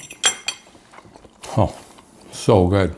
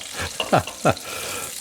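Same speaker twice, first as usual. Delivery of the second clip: airy giggle; 4 semitones higher, 8 dB quieter than usual. Real, not acted. Excited chef giggles again.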